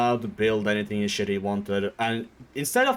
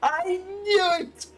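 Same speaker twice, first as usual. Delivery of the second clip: much higher giddy cheer; mock excited; slower than usual. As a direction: squeal with joy like a kid. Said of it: "he's like".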